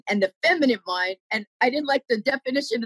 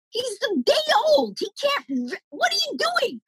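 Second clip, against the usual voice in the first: incredulous onlookers. Retold or imitated. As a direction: imitate people shocked by the poster. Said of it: "people were like".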